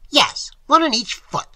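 high, loud, exasperated answer; heated retort. A narrator's reading. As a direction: snap it back high and loud. Professor's heated retort in argument.

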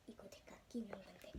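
secretive whisper. Real, not acted. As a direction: whisper it like a confession. Whispering about a treasured item.

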